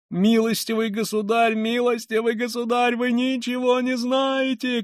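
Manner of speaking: very high, strained protest; crying out. A narrator's reading. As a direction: cry out over him, drawing out the end. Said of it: "кричала Катерина Ивановна". (высокий отчаянный крик)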